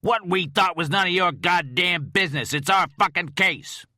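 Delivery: captain shouting at the agent; furious shout. Acted. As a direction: blow up at him. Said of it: "Poling shouted".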